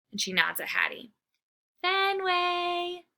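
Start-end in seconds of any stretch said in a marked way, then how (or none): 1.8-3.0 put-on girl calling. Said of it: "Hattie calls".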